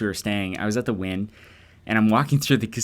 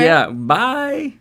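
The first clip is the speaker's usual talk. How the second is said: mock cheery bye; 11 semitones higher, 6 dB louder than usual. Playing along with exit.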